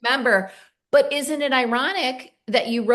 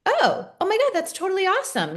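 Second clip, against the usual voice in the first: much higher, fast gushing praise; excited cheer; 10 semitones higher, faster than usual. Retold or imitated. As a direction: cheer your sister on, delighted. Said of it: "and I'm like".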